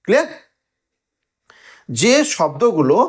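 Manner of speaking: ordinary talk, nothing marked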